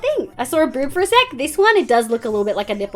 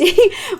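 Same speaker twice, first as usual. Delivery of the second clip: bright laugh; 10 semitones higher, 7 dB louder than usual. Real, not acted. Partner asks what this is.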